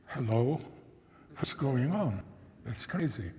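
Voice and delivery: normal talking voice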